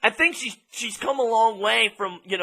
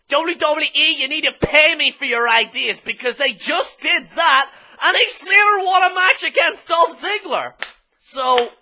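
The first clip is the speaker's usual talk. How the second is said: triumphant gloating; 7 semitones higher than usual. Real, not acted. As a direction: crow about being right. Triumphant claim to WWE.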